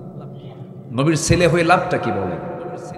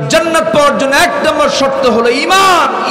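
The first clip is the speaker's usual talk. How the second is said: much higher, loud ringing cry on Iman; climactic shout; 12 semitones higher, 7 dB louder than usual. Acted. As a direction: hammer the crowd with Iman. Preacher's climax on faith. (উচ্চস্বরে চিৎকার করে ইমান)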